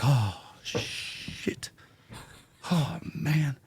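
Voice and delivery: imitating a stunned, drawn-out breathy worry; nervous dread. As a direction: let the oh-no sink in slowly, half under your breath. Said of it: "when Michael gets really nervous".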